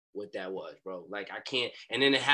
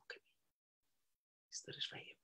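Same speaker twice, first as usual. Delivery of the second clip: whisper; 23 dB quieter, slower than usual. Retold or imitated. Retelling whispered call.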